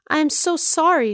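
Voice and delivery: high flustered apology; apologize, embarrassed; apologetic. Acted.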